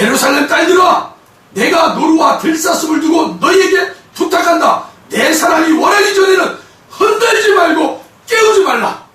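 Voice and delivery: high, forceful scripture proclamation; solemn charge proclaimed. Acted. God charging the daughters of Jerusalem, forceful proclamation. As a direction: proclaim it as a ringing command.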